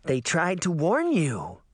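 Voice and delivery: put-on slow, taunting girl voice; a sinister child gloating; lightly voiced eerie girl; creepy little girl. A narrator's reading.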